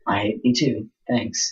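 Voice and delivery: low, soft deadpan reply. Retold or imitated. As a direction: shrug off the insult dryly. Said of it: "I'm like".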